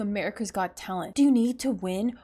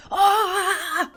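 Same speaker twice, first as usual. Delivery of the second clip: sudden high yelp of shock; surprised high shriek; 9 semitones higher, 6 dB louder than usual. Real, not acted. Reacting to a quiz answer, sudden high shriek. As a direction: squeal in disbelief at the quiz.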